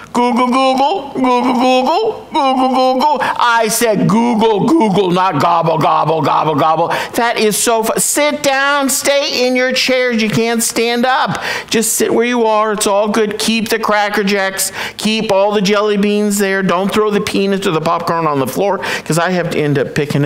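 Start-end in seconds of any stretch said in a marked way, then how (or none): 0.1-3.2 a turkey mangling Google into gobbles; gobbling turkey. Acted. Turkey's punchline, gobbling call. 3.4-6.9 a turkey mangling Google into gobbles; gobbling turkey. Acted. Turkey voice continues, correcting. 7.2-18.8 higher, tongue-in-cheek pleading to sit down; hammed-up plea to a laughing crowd; pretending the room is in stitches; mock crowd control. Mock crowd calming after joke.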